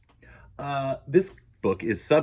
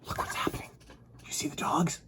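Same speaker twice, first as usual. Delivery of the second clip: whisper; 7 dB quieter than usual. Real, not acted. Host confides to kids.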